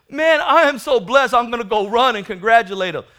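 loud, high glad shout; mock-joyful brother cheering; joyful congratulations. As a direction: celebrate your brother out loud. Brother's ideal joyful reply voiced.

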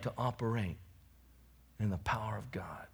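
hushed, breathy whispered aside. Real, not acted. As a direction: drop it to a whisper for effect.

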